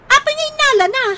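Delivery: jolted into alarm; startled demand. Acted. Mother alarmed about shoes.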